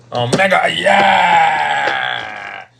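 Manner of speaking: loud cheer; excited shout. Real, not acted. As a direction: yell it out in triumph. Cheering a big play.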